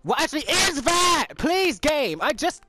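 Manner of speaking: high, loud exasperated yell; frustrated yelling. Real, not acted. Gamer yelling at the game after losing progress. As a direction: rage at the game for undoing hard work.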